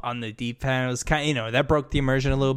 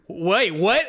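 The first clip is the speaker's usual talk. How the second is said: stunned, laughing "wait, what"; a light laugh of disbelief; mock surprise; 4 semitones higher, 3 dB louder than usual. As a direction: react as if the news just landed. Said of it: "You're like".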